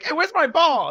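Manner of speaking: high, loud indignant call; Larry's complaint. Retold or imitated. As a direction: call out for your lost ball, indignant. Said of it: "and Larry's like".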